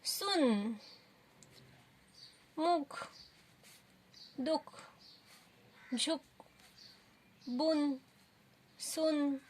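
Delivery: put-on slow, clear drill words for children to echo; repeat after me. Shown as a demonstration. Teacher to class, repeat-after-me word drill, clear and slow. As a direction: say सुन and मुख slowly so kids can copy. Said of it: "आप मेरे पीछे बोलोगे".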